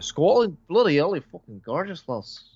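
put-on terrible Scottish accent. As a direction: gush in a hammed-up Scottish accent. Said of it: "attempt to do the bloody Scottish accent".

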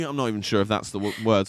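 usual speaking voice

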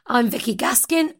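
ordinary delivery

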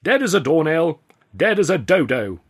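loud, emphatic pronouncement; dramatic proclamation. A narrator's reading. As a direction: declare it with grave relish. Dramatic announcement of death.

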